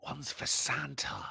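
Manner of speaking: unvoiced joking whisper. Whispering a joke about the spare plate. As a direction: breathe it as a secret aside.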